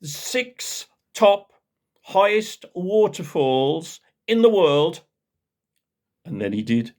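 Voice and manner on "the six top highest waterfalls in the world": high, slow, louder pompous boast; louder posh bragging. Retold or imitated. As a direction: show off even louder. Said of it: "And louder still".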